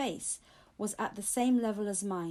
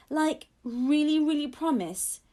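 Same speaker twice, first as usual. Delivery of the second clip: eager; 6 semitones higher, 5 dB louder than usual. Acted. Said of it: "suddenly feeling happy again".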